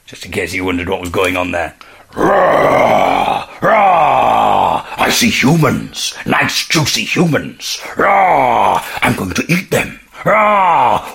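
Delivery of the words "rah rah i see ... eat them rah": slow growling bear voice; a hungry bear, growling and slow; playful monster growl. Pretend. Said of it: "We're entering the mind of a bear".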